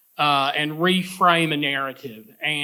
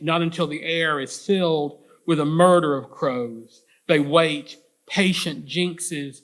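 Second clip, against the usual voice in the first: measured, emphatic poem recital with deliberate phrase breaks; poetry reading; 3 semitones higher, 3 dB louder than usual. Acted. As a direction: read the poem out to an audience, stressing key images. Reads own poem aloud.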